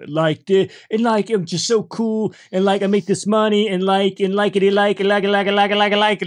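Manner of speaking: mock rambling cool-guy voice; mocking like-filled chatter. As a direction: imitate him looping on "like" like a stuck record. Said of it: "That's all this kid was saying".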